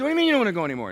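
a startled father raising his voice in disbelief; incredulous shout. Retold or imitated. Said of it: "I said".

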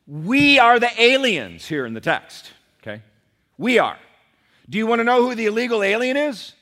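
loud, high preacher's proclamation; full-force preaching. Real, not acted. Preacher hits main point.

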